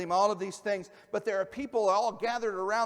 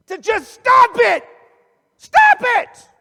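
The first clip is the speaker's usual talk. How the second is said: screaming "stop it" much higher and louder; psychologist screaming; 21 dB louder, much slower than usual. Retold or imitated. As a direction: scream it at the patient in full force. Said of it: "he just starts screaming at him".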